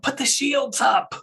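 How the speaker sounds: high-pitched, drawn-out mock yell; distant crew member yelling an order; background yell. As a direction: call it out high, as if from across the room. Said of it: "you hear somebody yelling in the background".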